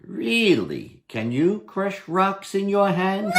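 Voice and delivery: high mocking challenge; cocky Herschel. Herschel mocks the little goblin, high cocky taunt. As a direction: tease him, raise the voice high.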